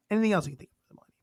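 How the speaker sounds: quick whispered repeat of "Anything else you can think of for the millennium". Pretend.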